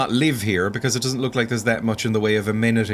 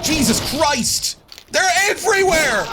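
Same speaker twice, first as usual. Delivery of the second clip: high, loud cry of alarm; panicked yell; 4 dB louder than usual. Real, not acted. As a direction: shout as enemies pour in. Swarmed by monsters.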